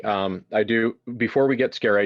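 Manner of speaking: normal talking voice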